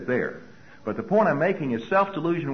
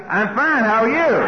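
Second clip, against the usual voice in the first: forced cheer; 6 semitones higher, 6 dB louder, slower than usual. Retold or imitated. Covering fear with a loud reply.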